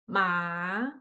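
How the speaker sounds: "ma" starting low, rising slowly; second tone. Shown as a demonstration. Recap of tones in order, "ma" rising. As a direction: slide "ma" up from low. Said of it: "Now we start again".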